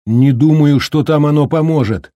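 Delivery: громко, перекрикивая ветер; крикнуть ответ сквозь вьюгу; прокричал в ответ. Acted. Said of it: "прокричал Холлоран в ответ". (громкий ответный крик)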